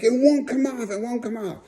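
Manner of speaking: high-pitched mock whimper of panic; imitated dismay. Friend scrubbing face in dismay.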